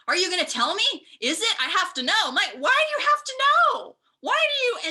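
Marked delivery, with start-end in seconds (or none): 0.1-2.1 pushy stranger. Retold or imitated. Grocery store strangers pestering her. 2.6-4.9 high, loud, incredulous whine; a hammed-up why-do-you-care squeak; exasperated retort. Exasperated retort to nosy strangers.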